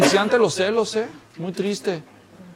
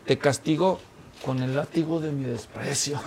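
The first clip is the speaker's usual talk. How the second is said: desprecio teatral; 4 semitones lower, 8 dB quieter than usual. Acted. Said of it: "hay un refrán por ahí".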